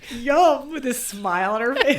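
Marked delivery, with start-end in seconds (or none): 0.1-0.7 forced, higher and louder yum; mock enthusiastic yum; fake delight. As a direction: pretend to love the cheese. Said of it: "you have to act like you love it".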